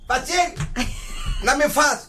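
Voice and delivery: high-pitched hey-you from a pastry; croissant calling out. Retold or imitated. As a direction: play a treat begging to be eaten. Voices the croissant calling to him. (παιχνιδιάρικο, ψιλό κάλεσμα κρουασάν)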